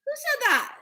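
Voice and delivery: high, startled stranger; a hidden voice surprised; voice behind the wall. Acted. A voice behind the wall asks Usha, high and surprised. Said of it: "asked a voice from behind the wall".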